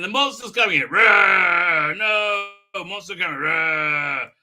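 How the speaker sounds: hammed-up warning with drawn-out roars; mock monster alarm. Reviewer acting out the monster coming in, mock alarm and roars. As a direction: play both panic and monster, lightly.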